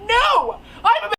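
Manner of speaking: high, loud "no!"; exasperated yell of refusal; mock-outraged protest. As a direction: burst out objecting, hammed-up. Retold protest to texting friend.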